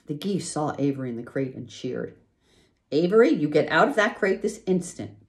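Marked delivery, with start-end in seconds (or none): 2.9-5.3 higher, louder stern order; mother commanding her son. A narrator's reading. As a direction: order the boy out now. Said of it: "commanded his mother".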